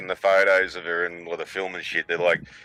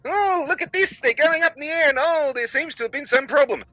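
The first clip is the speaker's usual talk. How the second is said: very high sing-song falsetto; squeaky put-on wonder, sending up the broadcast; mock launch commentary. Presenter showing kids the launch, mock falsetto awe.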